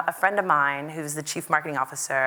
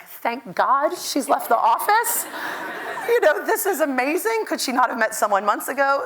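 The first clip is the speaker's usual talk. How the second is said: relieved team; 8 semitones higher than usual. Retold or imitated. Team relieved she left office.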